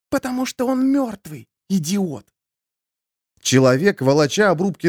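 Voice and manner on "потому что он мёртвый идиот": high sharp hiss at a fool. Acted. Elas hisses at Imid in the dark. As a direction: snap it under the breath. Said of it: "прошипела".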